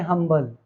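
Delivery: हम्बल begun with a clear H; modern sounded H. Shown as a demonstration. Teacher repeating today's pronunciation of humble: हम्बल with H sounded. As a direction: keep the H in हम्बल. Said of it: "अब इसे हम pronounce करते हैं".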